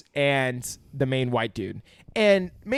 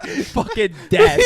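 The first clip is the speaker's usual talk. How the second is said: ordinary delivery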